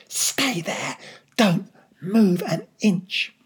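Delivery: slow, emphatic stage whisper. A narrator's reading. Father warning his son to keep still, forceful whisper. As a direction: whisper each word as an order. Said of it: "my father whispered".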